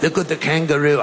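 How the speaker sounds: put-on Australian accent falling apart; badly faked Australian accent; shaky Australian accent. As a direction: attempt an Aussie accent and let it slip. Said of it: "my accent's falling apart as I do it".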